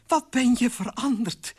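high, loud exclamation; amazed delight. Acted. Queen sees her changed husband, high and amazed. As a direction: exclaim it in astonished admiration.

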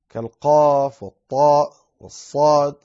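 normal talking voice

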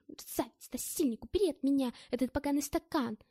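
furious yelling. Acted. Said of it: "кричала Маша".

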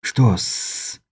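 soft voiceless whisper. A narrator's reading. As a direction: breathe the words, no voice. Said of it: "шепнул Каспер". (шёпотом)

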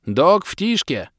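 loud, slow, regretful shout; regretful yell. A narrator's reading. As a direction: yell it with a sorry tone. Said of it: "с сожалением завопил я". (громкий выкрик с сожалением)

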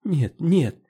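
high, distraught no no; on the verge of sobbing; close to tears. A narrator's reading. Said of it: "Готова была зарыдать".

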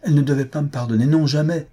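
fast and high, rushing out the protest; extreme liveliness. A narrator's reading. Willoughby bursting out to Elinor, fast and high. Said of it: "s'écria-t-il avec une extrême vivacité".

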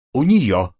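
ordinary delivery

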